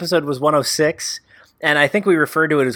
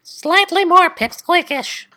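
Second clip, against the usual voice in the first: squeaky, slow and much higher; pipsqueak voice; 14 semitones higher, 3 dB louder, slower than usual. Pretend. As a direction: shrink the voice to a tiny squeak. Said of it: "altering [name]'s voice so that he sounds like".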